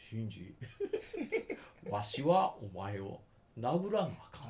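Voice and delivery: put-on slow, old-style stage Kansai; old comedic Kansai. Shown as a demonstration. Scene: demoing the anime's fake old-style Kansai, hammed. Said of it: "藤山寛美系".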